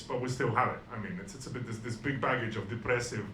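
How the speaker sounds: ordinary delivery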